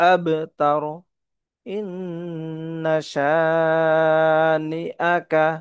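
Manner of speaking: drawn-out melodic chant; slow tajweed chant; slow recitation. Shown as a demonstration. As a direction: recite slowly for learners. Recites verse slowly.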